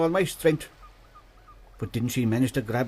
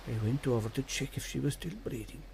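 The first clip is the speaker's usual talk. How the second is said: hushed suspense; 4 semitones lower, 11 dB quieter than usual. Acted. Suspense before the seal attacks.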